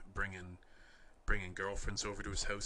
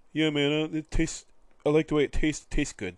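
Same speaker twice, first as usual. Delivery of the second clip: goofy dude voice; 7 semitones higher, 13 dB louder than usual. Retold or imitated. Kyle defending eating raw chicken.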